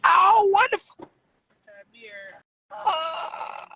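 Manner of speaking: loud, high yelp of pain; pained shout. Real, not acted. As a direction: cry out from sudden pain. Sharp pain reaction.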